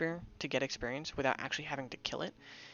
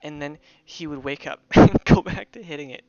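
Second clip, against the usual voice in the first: laughing hard; 12 dB louder, slower than usual. Real, not acted. Laughing at spear dwarf scene.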